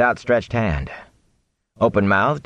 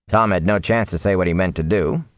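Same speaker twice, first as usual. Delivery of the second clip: ordinary delivery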